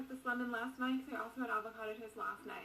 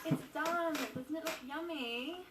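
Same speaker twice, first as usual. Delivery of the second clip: light mock British accent, a touch higher; 3 semitones higher, 8 dB louder, slower than usual. Vlogger mimicking a British accent while making toast, mock British accent. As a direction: put on a playful British accent for the viewers. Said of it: "Let's switch to British".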